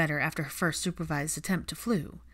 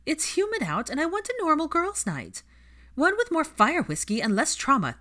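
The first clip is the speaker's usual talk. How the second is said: whiny complaint; 10 semitones higher, 4 dB louder than usual. Acted. Pansy's sulky complaint.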